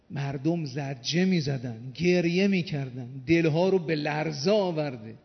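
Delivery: forceful, drawn-out climax of a sermon; impassioned preaching. Acted. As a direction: preach it with swelling intensity. (خطابهٔ پرشور و کوبنده)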